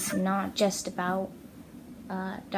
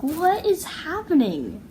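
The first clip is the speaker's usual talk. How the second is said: higher, slow puzzled dog voice; puzzled dog, higher and drawn out; dog's voice; 8 semitones higher, 5 dB louder, slower than usual. Retold or imitated. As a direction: voice the head-tilting dog, slowly. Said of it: "just tilts her head like".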